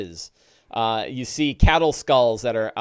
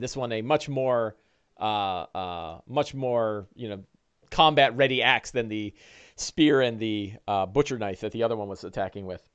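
ordinary talk

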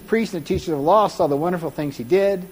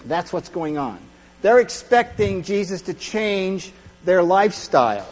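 ordinary delivery

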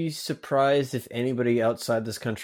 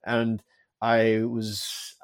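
ordinary talk

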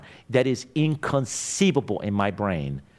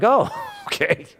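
laughing; 13 semitones higher than usual. Retold or imitated. Amused reply to dispatcher story.

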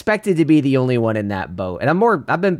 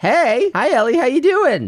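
high, louder sing-song greeting; 12 semitones higher, 4 dB louder than usual. Real, not acted. Greeting toddler over phone.

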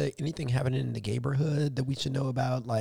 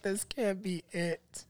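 slow, giggling through a quote; laughing mock despair; 8 semitones higher, 6 dB quieter, much slower than usual. Telling cohost about work dread, laughing slowly. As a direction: laugh out a hammed-up complaint.

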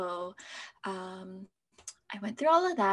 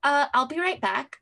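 hurried excuse; 5 semitones higher, 7 dB louder than usual. Retold or imitated. Excusing herself from friends while panicking.

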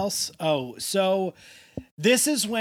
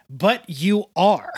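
"But you are" slow, loud and higher, each word leaned on; emphatic comeback; 4 semitones higher, 9 dB louder, slower than usual. Retold or imitated. Jenna answers his fear of failure, "But you are" slow and emphatic. Acted blunt comeback in retold argument.

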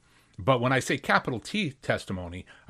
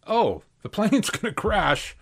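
sudden realization; 8 semitones higher, slower than usual. Retold or imitated. Man realizes plane will crash.

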